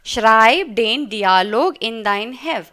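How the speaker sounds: very slow and loud, word by word; slow German dictation. Shown as a demonstration. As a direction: read it slowly for pupils to copy. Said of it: "repeated model sentence".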